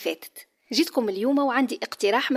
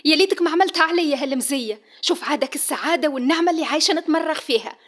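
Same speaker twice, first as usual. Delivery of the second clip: higher, louder sarcastic outburst; bitter shout; 5 semitones higher, 5 dB louder than usual. Acted. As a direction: drip sarcasm at him. Wife lashes out sarcastically. (سخرية بغضب)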